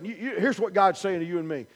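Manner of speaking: ordinary delivery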